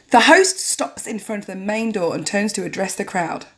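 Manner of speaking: ordinary talk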